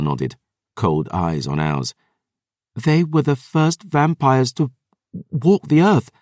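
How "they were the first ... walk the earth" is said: put-on lightly higher girl's voice, shaking; young woman voice. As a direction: voice Dior, trembling with feeling. Dior's voice, cold eyes.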